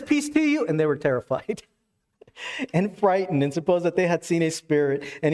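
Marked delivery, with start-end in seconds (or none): 0.0-0.7 "Peace to you" lifted much higher, cheerful and sudden; a high, sunny call of peace; bright greeting. Retold or imitated. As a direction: pop out with a high, startling hello. Jesus greets the frightened disciples.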